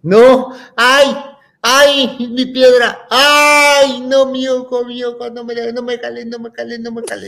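high yelps tailing into pleading; hammed-up child howling in pain; loud cries sinking to a high, repeated plea; pained protest. Child resisting eye being pried open.

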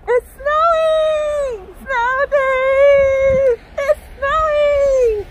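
high, loud, drawn-out cries of joy; excited shouting. Real, not acted. Woman filming the snow shouting with joy, high and loud.

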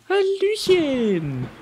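high, slow drawn-out Hallöchen; slow squeaky baby-talk greeting; cutesy high hello. Acted. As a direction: coo it to a kitten. Greeting last cute cat.

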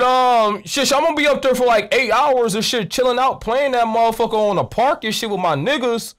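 ordinary talk, nothing marked

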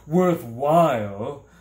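loud, drawn-out outraged echo; indignant roar. A narrator's reading. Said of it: "the man roared indignantly".

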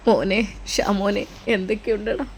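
put-on an old father weeping as he greets his son; understated crying, slowed right down; tearful father. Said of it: "പിതാവ് പൊട്ടിക്കരയുകയാണ് ചെയ്തത്".

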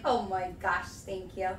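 normal talking voice

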